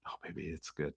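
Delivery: hushed, tender murmur; soft whisper. Retold or imitated. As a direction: barely breathe it out softly. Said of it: "And I'd be like".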